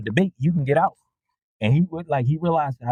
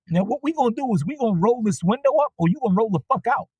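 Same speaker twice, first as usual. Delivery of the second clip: scolding; 8 semitones higher, faster than usual. Retold or imitated. Driver scolding rude passenger.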